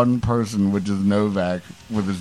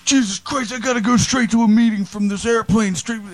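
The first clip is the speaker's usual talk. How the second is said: much higher, whiny mock voice; imitating Novak; 11 semitones higher than usual. Friend on a plane mocked, much higher whine. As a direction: squeak it out like a flustered drunk. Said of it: "and he's just like".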